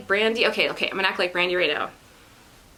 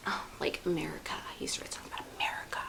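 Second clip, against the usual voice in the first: whisper; 5 semitones lower, 11 dB quieter, much faster than usual. Acted. Whispered aside.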